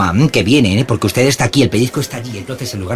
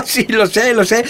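laughing; 9 semitones higher than usual. Real, not acted. Clerk laughing at megaphone joke.

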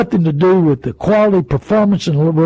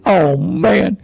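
normal talking voice

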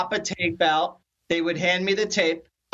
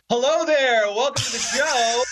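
loud, higher mock TV host greeting; on-air host switched on; 6 semitones higher, 3 dB louder than usual. Showing how people switch on once the camera goes on, mock announcer. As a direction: burst into a big show-opener voice. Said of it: "once the camera goes on, it's".

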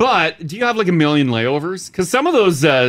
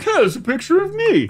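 high bear voice. Retold or imitated. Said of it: "He's like".

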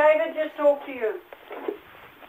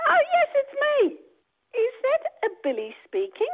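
higher, put-on motherly phone voice; pretend mum; 8 semitones higher than usual. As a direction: play along as the boy's mother, pitched up. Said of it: "could you say you're his mum".

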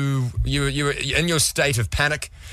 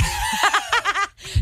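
high laugh. Real, not acted. Co-host laughing at his own joke, high laugh.

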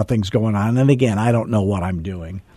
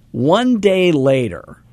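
"one day later" drawn out slowly on a high pitch; dramatic reveal; 7 semitones higher, slower than usual. Real, not acted. Agent reveals how fast the house sold, dramatic "one day later", slow and high. Punchline of the listing story.

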